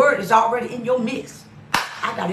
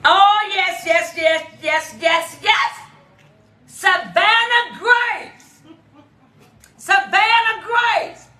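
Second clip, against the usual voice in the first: excited yes; 7 semitones higher, much slower than usual. Real, not acted. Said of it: "I get so excited".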